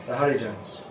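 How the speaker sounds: usual speaking voice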